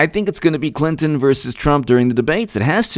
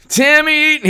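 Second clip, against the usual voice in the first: very high, playful name call; squealed greeting to a viewer; high-pitched shout-out; 14 semitones higher than usual. Real, not acted. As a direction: sing out the name in falsetto.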